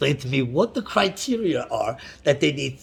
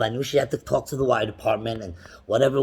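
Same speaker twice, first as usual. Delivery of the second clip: ordinary delivery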